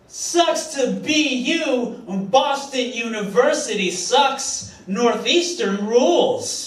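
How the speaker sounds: high, slow taunting jeer building to a shout; high sing-song taunt ending in a yelled cheer; mocking heckler yell. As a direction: drag it out like a rival-school heckler. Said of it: "what she actually said was".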